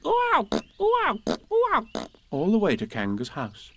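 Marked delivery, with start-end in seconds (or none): 0.1-2.1 very high repeated squeals; bounced "ooh, ow". A narrator's reading. Piglet jolted all the way home, squeaky yelps. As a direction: squeal up and yelp down with each bounce. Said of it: "And he was saying".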